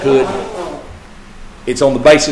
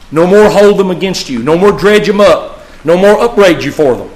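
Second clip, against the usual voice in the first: louder, higher, each "No more" hammered; emphatic preaching; 6 semitones higher, 5 dB louder than usual. Acted. Preacher declaring God's promise, emphatic preaching. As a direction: pound each "No more" home. Preacher quoting God forcefully.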